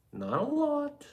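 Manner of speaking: comic falsetto aside; squeaky falsetto. Acted. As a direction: shoot up into a tiny high squeak. Sudden squeaky joke voice.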